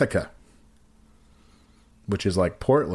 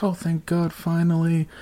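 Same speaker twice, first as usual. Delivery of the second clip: higher-pitched imitation of relief; retold happy sigh of relief, pitched up; 8 semitones higher than usual. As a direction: mimic her delighted relief. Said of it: "And she's all happy".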